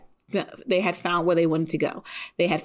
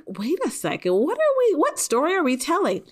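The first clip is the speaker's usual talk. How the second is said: mock bewilderment; 10 semitones higher than usual. Viewer reacting to the unexpectedly campy opening, mock bewilderment. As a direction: pitch leaps high in comic disbelief.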